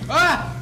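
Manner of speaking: sudden high scream; startled scream. Real, not acted. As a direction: yelp in fright. Sudden scare in game.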